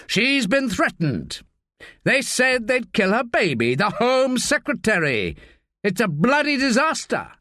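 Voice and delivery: a boss fuming down the phone; lightly voiced exasperated superior; harassed senior officer. A narrator's reading. Narrator voices Pennington.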